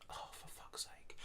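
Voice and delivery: exasperated whisper; whispered, slow, fed-up sigh of words; bothered penguin. Acted. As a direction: mutter it under your breath, barely audible. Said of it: "when a penguin is, uh, bothered by something, it goes".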